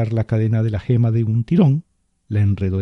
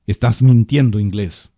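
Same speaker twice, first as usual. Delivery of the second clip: angry accusation; 6 dB louder than usual. A narrator's reading. Spirit accusing the major.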